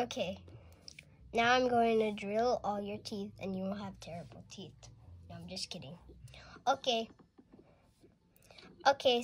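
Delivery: ordinary delivery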